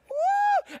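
ecstatic high whooping shout; imitating a wild racetrack fan's screaming woo. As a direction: whoop like a shirtless race fan cheering his driver. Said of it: "every time".

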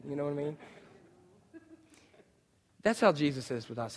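ordinary delivery

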